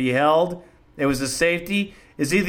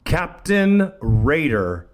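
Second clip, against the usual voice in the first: announcer introduction; 4 semitones lower than usual. Real, not acted. Host introduces the next caller.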